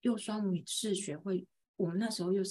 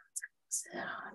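whispering; 13 dB quieter than usual. Retold or imitated. Inner thought during class.

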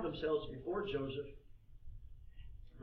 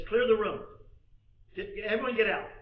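loud, raised-pitch order; urgent command; 5 semitones higher, 8 dB louder than usual. Acted. Joseph breaking down, ordering his servants out urgently. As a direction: clear them out with force.

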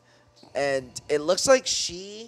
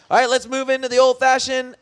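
high, loud segment intro; hyped announcer; 10 semitones higher, 8 dB louder than usual. Acted. Host launching the Game Corner segment, loud hype. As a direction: belt it out like a game show host.

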